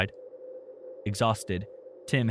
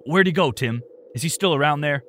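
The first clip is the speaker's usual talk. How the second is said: louder, higher urgent questions; raised voice; 4 semitones higher, 5 dB louder than usual. Acted. Uncle Rick presses Tim about his missing son, loud and urgent. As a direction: demand it urgently over the phone. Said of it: "again raising his voice".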